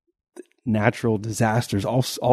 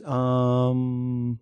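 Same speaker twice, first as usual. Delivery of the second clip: slow, drawn-out thinking um; Geo impression; 4 semitones higher than usual. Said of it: "he'll put his hand on his face like he's thinking".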